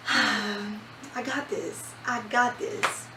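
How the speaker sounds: slow, hushed self-talk; calm yourself down, slowly; slow self-reassurance. Retold or imitated. Telling herself before C-section.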